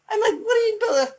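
very high-pitched mock disbelief; mock incredulous. Preacher reacting to Peter's building plan, mock incredulous.

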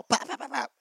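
high, quick pops; playful zap noises. Pretend. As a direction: pop the sounds out like a cartoon. Imitates zapping sounds.

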